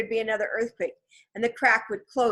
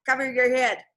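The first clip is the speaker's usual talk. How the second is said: raised, higher warning call; moderately raised, urgent command; urgent warning shout; 4 semitones higher, 4 dB louder than usual. A narrator's reading. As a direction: call out a warning, a touch restrained. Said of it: "shouted Jack".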